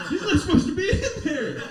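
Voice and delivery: high, loud mock alarm; shocked outburst. Realtor spots a man who shouldn't be there, alarmed.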